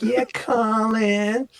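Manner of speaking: high, slow New York drawl; high-pitched Brooklyn purr; New York accent. Retold or imitated. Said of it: "She's talking in a New York accent".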